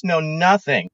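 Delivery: usual speaking voice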